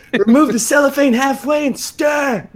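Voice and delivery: high, loud laughing shout of the instructions; mock shout. Mocking a cooking show with Hot Pockets, laughing shout. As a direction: yell the box directions like a furious chef.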